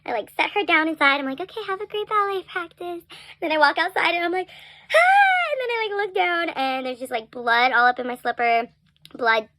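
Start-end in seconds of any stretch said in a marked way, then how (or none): 1.4-3.0 bright, hushed cheer hiding pain; forced calm cheer. Real, not acted. To her niece at ballet, a falsely cheerful goodbye. 4.5-5.5 sudden high loud cry of pain; scream of pain. Real, not acted. Alone outside after hiding her pain, a released scream.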